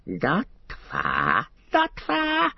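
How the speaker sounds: high, strained creature voice; a sly, creeping creature calling down from above; Gollum voice. A narrator's reading.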